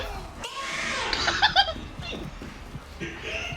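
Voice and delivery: shrieking burst of laughter. Real, not acted. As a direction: howl with laughter. Big laugh at game moment.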